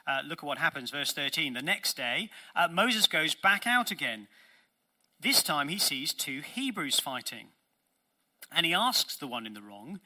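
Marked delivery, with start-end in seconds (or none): none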